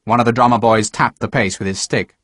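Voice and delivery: plain reading voice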